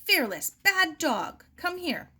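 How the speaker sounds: slow, loud scolding call; scolding the dog. Acted. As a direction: tell off a bad dog from afar.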